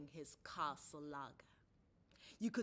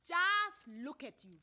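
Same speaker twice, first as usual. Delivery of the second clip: sharp contempt; 7 semitones higher, 10 dB louder, slower than usual. A narrator's reading. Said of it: "she eyed him sharply".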